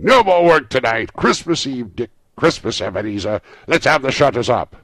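loud, high, festive announcement; cheerful boss calling a holiday; hearty orders called to the lads; jovial cry. A narrator's reading. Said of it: "cried old Fezziwig with a sharp clap".

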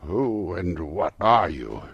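loud, slow demand. A narrator's reading. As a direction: press the question hard and slow. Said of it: "Scrooge demanded".

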